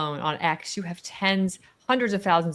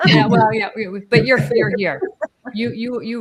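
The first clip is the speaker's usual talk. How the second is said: laughing; 4 semitones higher, 7 dB louder than usual. Real, not acted. Reacting to joke, laughing.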